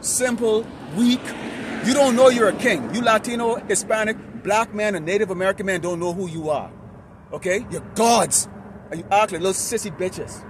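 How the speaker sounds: scolding street-corner sermon; forceful street preaching. Real, not acted. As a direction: preach hard at the crowd.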